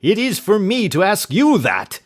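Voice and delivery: loud, high shriek. Acted. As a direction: shriek it back in rage. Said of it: "he shrieked".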